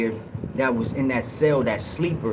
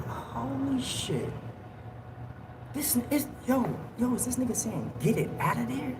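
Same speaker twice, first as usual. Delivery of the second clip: stunned hush; 9 semitones higher, 9 dB quieter than usual. Retold or imitated. Stunned, listening secretly through the vent.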